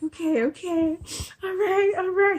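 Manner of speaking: high, trembling, near tears; scared okay. Retold or imitated. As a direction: agree shakily, about to cry. Frightened reply after the threat.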